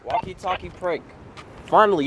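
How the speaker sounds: ordinary talk, nothing marked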